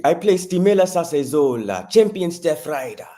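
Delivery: South African accent. Shown as a demonstration. Actor shows the host his South African stage accent. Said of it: "I have to talk in a South African accent".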